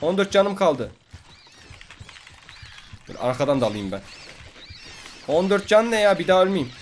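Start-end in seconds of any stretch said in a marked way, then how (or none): 0.0-0.9 high, loud panicked cries about dying; panicked yelling. Real, not acted. Gamer panicking while his llama gets killed, loud and high. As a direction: yell in a panic, pitched up.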